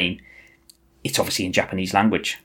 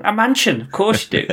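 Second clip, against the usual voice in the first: sarcastic; 11 semitones higher than usual. Acted. Sarcastic answer to own question.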